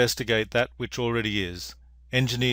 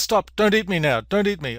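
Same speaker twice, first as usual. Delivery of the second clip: higher, urgent rabbit begging for mercy; acted high-pitched protest; mock pleading; 8 semitones higher, 5 dB louder than usual. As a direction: plead loudly as a cornered storybook rabbit. Rabbit begging fox for life.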